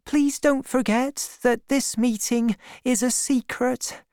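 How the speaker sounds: put-on high-pitched girl, pleading; secretive young girl. A narrator's reading. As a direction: urge secrecy in a high girl's voice. Girl begging that meeting stay secret.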